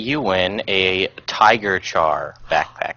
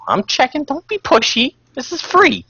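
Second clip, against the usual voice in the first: much higher, louder playful snapping; high mock scolding voice; 4 dB louder than usual. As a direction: pretend to be a prissy shopkeeper.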